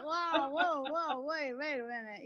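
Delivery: wow and whoa said through a laugh; laughing disbelief. Retold or imitated. Telling the host how shocked she was, laughing disbelief. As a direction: play up the amazement, laughing. Mock disbelief at her own results.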